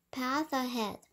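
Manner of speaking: usual speaking voice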